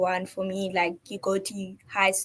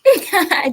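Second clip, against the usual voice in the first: loud high laugh; a real burst of laughter; 10 semitones higher, 10 dB louder than usual. Real, not acted. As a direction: laugh at the joke. Laughing at host's joke.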